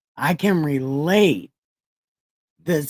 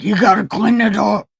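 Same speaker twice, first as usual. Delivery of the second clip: loud, higher-pitched retold scolding; mock mad; 7 semitones higher, 7 dB louder, faster than usual. Dustin scolding about the party pen, retold mad. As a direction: imitate him nagging, raised voice. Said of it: "getting really mad at me".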